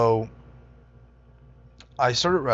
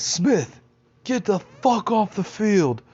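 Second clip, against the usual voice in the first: high, loud mock coach bark; angry coach; 11 semitones higher, 5 dB louder, much slower than usual. Coach ordering the late player off the field, high loud bark. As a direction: bark at him like a fed-up coach. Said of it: "He says".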